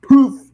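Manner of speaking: loud high "poof" sound effect; Markiplier poof. Pretend. Narrator mimics Markiplier's poof for the flash of light. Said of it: "I'm gonna do the Markiplier".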